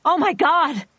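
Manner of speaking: loud, high cry of shock; frightened cry. Acted. Doctor falling to her knees at gunshots, loud scared cry. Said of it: "she cried to no one".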